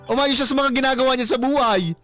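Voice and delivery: ordinary delivery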